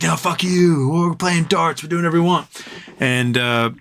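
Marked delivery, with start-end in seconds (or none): 0.0-2.5 higher, brash bully voice; the A road refusing to let anyone leave, higher brash bully voice. Retold or imitated. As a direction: throw it at the whole pub, higher. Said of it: "He's like".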